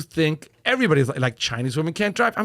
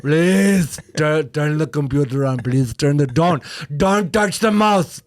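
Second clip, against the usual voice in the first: put-on upset Indian IT guy accent, rising to a shout; weary patient tech support; 6 semitones higher, 3 dB louder, slower than usual. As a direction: Indian accent, pleading then yelling at users. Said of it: "an impression of an I T guy now just getting upset".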